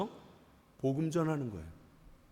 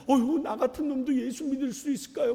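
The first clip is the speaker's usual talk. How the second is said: higher-pitched mock astonishment; imitated tearful amazement; 7 semitones higher than usual. Imitating a stranger moved by a church invitation, tearful amazement. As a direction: act out an overwhelmed new believer. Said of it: "갑자기 눈이 이만해지면서".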